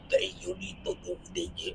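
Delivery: breathy, voiceless, very soft creature voice; Count Orlok's rasping whisper. Retold or imitated. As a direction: hiss it out like a hidden vampire. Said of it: "talks like this".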